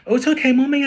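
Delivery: put-on high, loud little boy backing off; scared. A narrator's reading. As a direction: hurriedly placate Mummy, frightened. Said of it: "I say, scared".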